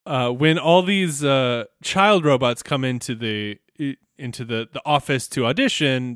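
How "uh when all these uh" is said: usual speaking voice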